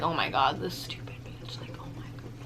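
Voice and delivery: whispered, irritated aside as the artist; mock annoyed whisper. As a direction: hiss it under your breath like a fed-up tattooist. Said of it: "he probably was pretty mad".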